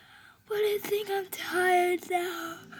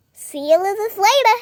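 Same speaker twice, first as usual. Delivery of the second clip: ordinary delivery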